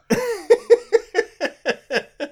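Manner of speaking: high burst of laughter. Real, not acted. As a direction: let out a big cackle. Big laugh at the joke.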